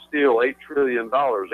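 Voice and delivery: usual speaking voice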